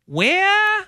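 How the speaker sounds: high, drawn-out call. Acted. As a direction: call out across the room. Calling out for the missing guys.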